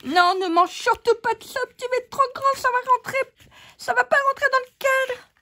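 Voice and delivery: high squeaky fuss; squeaky scolding voice. Pretend. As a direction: warn it like a fussy little critter. Voicing the craft piece.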